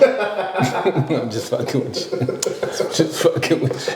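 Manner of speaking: teasing laugh, fading to breathy. Real, not acted. Teasing his cohost about a date, laughing. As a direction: laugh it off slowly.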